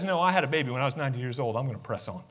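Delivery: Sarah calmly standing her ground; quiet certainty of an old woman who trusted; Sarah's steady reply. Acted. Sarah voiced answering doubters.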